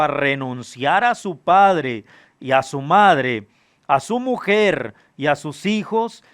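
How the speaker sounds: slow, emphatic Gospel reading, phrase by phrase; solemn scripture reading. Acted. Preacher reading Jesus' demands to the congregation, solemn scripture reading. Reads the Gospel passage aloud.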